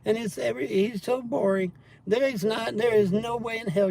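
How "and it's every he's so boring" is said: a light high whine of mockery; mild mocking of the trolls, a touch higher. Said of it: "The trolls say".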